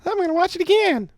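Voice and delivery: mock the line way up high in falsetto; high cartoon falsetto echo; silly high voice. Echoing partner in silly voice.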